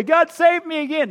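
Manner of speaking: loud, high mocking plea; loud mock prayer shouted high. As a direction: shout the wrong prayer to dismiss it.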